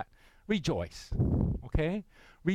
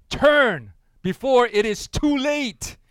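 higher, louder and slow, like a doom prophet; proclaiming the sign; 8 semitones higher, 8 dB louder, slower than usual. Acted. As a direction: boom out the warning slowly. Reading the priest's sign.

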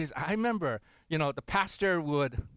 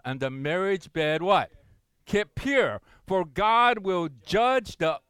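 slow, loud, emphatic reading; preacher reading Hebrews aloud; scripture reading; 5 dB louder, slower than usual. Acted. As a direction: proclaim the verse to the congregation.

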